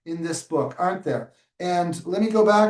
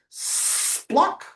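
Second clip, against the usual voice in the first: high breathy spluck of mud letting go; sucking splat sound; 12 semitones higher than usual. Acted. Teacher reading to children, breathy mud pop. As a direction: pop it out like mud releasing a foot. Sound word read in the story.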